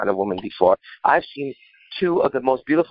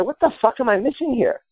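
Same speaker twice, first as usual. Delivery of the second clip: baffled exclamation; 11 semitones higher than usual. Retold or imitated. Baffled question to friend.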